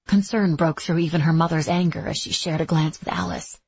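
ordinary delivery

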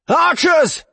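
loud, high-pitched bellow to the troops; bellowed command. A narrator's reading. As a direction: bellow for the archers. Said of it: "the Inquisitor on the ground bellowed".

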